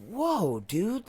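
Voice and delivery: high, drawn-out stunned whoa; amazed whoa. Retold or imitated. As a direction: act floored by what you see. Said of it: "I was like".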